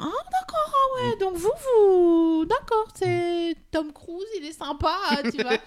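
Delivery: high, breathy-sweet guide voice mimicked; eager tour guide. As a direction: mimic a gushing, overeager guide. (voix de guide enthousiaste et aiguë)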